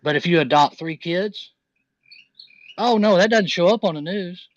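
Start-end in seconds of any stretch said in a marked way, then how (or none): 2.8-4.5 louder, higher mock-shocked "oh no"; sarcastic. Real, not acted. Host mocking the news for ignoring good deeds, sarcastic. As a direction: scoff at it as if it were obvious.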